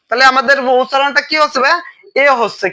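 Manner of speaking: ordinary delivery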